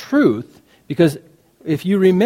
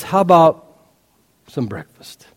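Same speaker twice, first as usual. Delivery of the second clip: tender offer. Shown as a demonstration.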